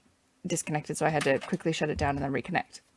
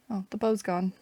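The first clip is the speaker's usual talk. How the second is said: ordinary delivery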